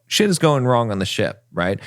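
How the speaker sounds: usual speaking voice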